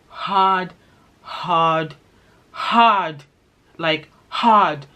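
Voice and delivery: "hard" said alone each time, pauses between; emphatic repetition. Real, not acted. Stressing to viewers how hard the accent was, emphatic repetition. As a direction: land each "hard" as its own heavy beat.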